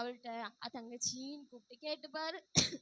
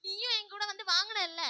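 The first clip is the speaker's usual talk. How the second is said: higher, much louder retort; shouted retort; 7 semitones higher, 13 dB louder than usual. Acted. Younger sister shouting back during the fight.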